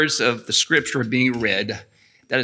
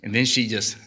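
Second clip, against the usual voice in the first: usual speaking voice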